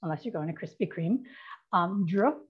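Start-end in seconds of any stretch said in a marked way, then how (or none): 1.7-2.4 dr said as a consonant blend, d then r, with no vowel; sounding out dr. Shown as a demonstration. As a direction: isolate the cluster dr. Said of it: "that combination of sounds".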